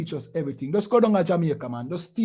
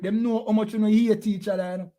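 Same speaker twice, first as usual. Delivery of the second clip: ordinary delivery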